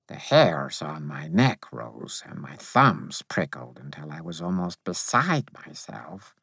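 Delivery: ordinary delivery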